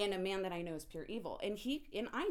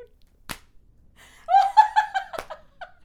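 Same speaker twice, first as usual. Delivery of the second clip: loud high laugh; 20 dB louder than usual. Real, not acted. Burst of laughter.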